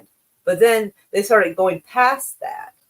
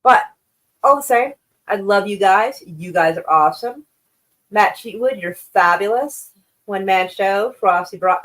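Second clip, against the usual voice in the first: normal talking voice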